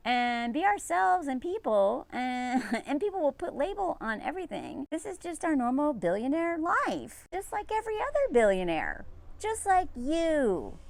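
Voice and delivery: high, slowing imitation of a cheerful wife; mock trad wife.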